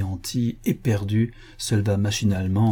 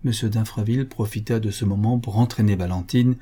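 ordinary delivery

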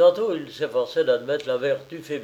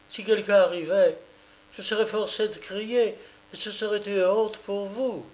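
higher, scornful dismissal; lightly voiced proud woman; 7 semitones higher than usual. A narrator's reading. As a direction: send him elsewhere, proud. Oulana sends him away.

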